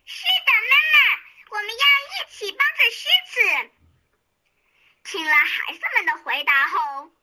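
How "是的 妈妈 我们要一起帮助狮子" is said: put-on high, loud, eager children's answer; the little ones bravely answering mother; brave mouse children. A narrator's reading. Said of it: "也都很勇敢地回答".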